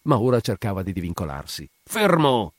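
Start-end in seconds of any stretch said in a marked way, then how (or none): 1.8-2.5 higher, louder cry of Fermo; shouted order. A narrator's reading. Guglielmi holding the struggling man, shouting. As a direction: yell at the struggling man to stop. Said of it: "gridava il Guglielmi".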